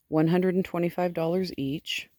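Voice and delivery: ordinary talk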